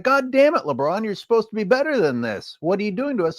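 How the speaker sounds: high disbelieving whine at the star; imagined fan scold. Retold or imitated. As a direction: scold LeBron like a let-down fan. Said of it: "instead of being like".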